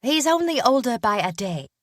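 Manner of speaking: put-on high, sulky girlish voice; grumbling little-sister whine; sullen young woman. A narrator's reading. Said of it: "She nodded sullenly".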